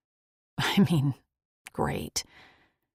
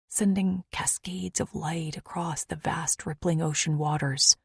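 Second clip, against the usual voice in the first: ordinary delivery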